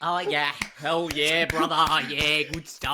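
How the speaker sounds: loud, lightly put-on cowboy; Aussie cowboy. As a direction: cheer along as an Aussie cowboy. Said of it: "I'm an Aussie cowboy now".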